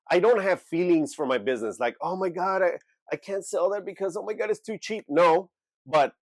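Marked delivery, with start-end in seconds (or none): 2.0-5.0 high, fussy gasping of a seller who can't let go; mild mock-sentimental seller voice. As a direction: ham up the clingy seller.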